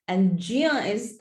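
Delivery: normal talking voice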